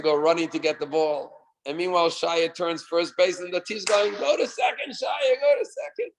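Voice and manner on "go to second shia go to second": high, eager calling; excited urging. Retold or imitated. Team urging Shaya to second, high excited calls. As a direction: push him on to the next base.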